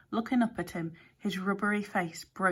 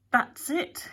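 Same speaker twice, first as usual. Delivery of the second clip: sudden higher eureka cry; burst out; 8 semitones higher, 4 dB louder than usual. A narrator's reading. Alex hitting on the plan, sudden excited outburst. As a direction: blurt it as the idea strikes. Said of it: "he burst out".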